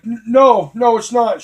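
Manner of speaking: high, loud, flustered denial; panicked denial. Retold or imitated. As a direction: blurt it out in a panic. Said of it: "I'm like".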